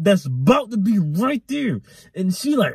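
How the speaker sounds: normal talking voice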